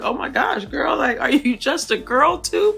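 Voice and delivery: high, loud, playful squeal of recognition; gushing girlfriend reaction. Retold or imitated. Viewer teasing a castmate as just a girl, gushing. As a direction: gush it up high, settling at the end.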